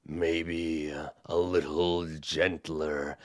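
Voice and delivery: quiet giant advice; soft giant. Acted. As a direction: ask it almost gently. Cyclops voice.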